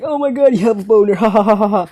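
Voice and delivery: imitated taunt with ha-ha laughter; mocking friend. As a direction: tease with a fake laugh. Friend mocking embarrassed kid.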